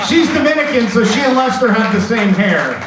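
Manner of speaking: loud laughing delivery. Real, not acted. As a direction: laugh through the joke. Laughing loud joke to crowd.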